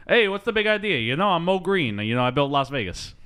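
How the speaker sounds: New York wise-guy chief, starting high; mock New York tough guy, tumbling out quickly; extremely New York. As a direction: put on a big New York accent. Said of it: "played by extremely New York people".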